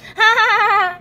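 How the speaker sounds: high, loud teasing giggle; high mocking laugh; mocking cat laugh. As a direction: laugh at him like a cheeky cat. Cats laugh at Keith.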